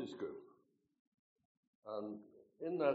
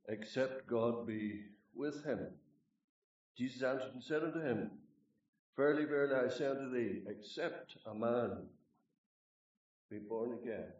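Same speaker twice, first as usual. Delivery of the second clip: scripture reading; 4 dB louder than usual. Acted. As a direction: declaim the verses with weight. Reads scripture aloud.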